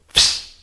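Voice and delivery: "Fish" as a long fsh, no vowel; vowelless fish. Shown as a demonstration. Showing fish spelled without an I, "Fish" as fsh. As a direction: squeeze "Fish" down to f and sh. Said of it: "That's what it is".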